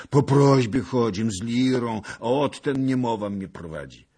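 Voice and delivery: put-on high, loud old man's plea; old beggar. A narrator's reading. Old lyre beggar explains himself to a lord, pleading. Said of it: "odpowiedział starzec".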